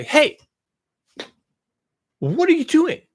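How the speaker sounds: high, loud indignant challenge; mock scolding. Imagined critic to the Chinese, mock scolding. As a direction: call them out in hammed-up outrage.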